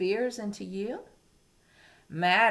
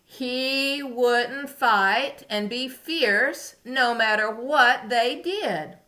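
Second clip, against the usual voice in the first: slow, higher storybook voice; reading to a toddler; 5 semitones higher, 8 dB louder, slower than usual. A narrator's reading. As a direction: read it slowly for little ones. Reading story to young children.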